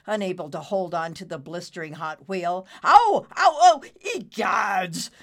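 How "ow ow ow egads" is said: loud, high yelps of pain. Acted. Merlin burns his hands, yelping loudly. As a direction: yelp as it burns.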